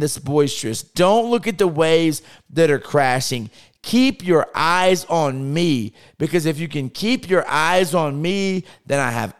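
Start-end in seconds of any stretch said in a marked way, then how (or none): none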